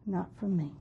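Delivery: ordinary delivery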